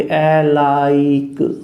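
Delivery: alike drawn out slowly, schwa, L, long glide, released K; sound by sound. Shown as a demonstration. Teacher dictates alike to students, stretched sound by sound. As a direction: spell alike out by its sounds, stretching each one. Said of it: "यूं लिखोगे".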